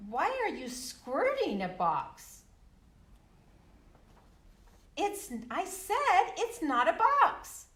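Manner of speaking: loud, high animated retelling; child insisting it's not a box, loud and high; reading to children. Acted. Retells book with voices.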